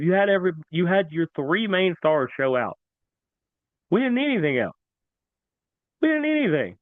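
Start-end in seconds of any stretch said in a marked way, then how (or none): 3.9-6.8 rising excitement, pitched way up; hyped sports-talk emphasis; emphatic. Real, not acted. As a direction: insist on it, getting more worked up. Emphatic point about star players.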